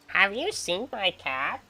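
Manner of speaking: very slow, drawn-out old-man impression in a changed voice; old man's voice. Doing the old neighbor asking about his cat, very slow. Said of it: "I swear that's the way he talks".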